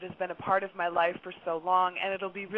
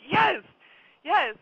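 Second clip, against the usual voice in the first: high, loud, thrilled double yes; eager yes; 9 semitones higher, 7 dB louder than usual. Retold or imitated. Telling a stranger she's a psychic, thrilled and loud. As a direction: burst out with delight at being asked.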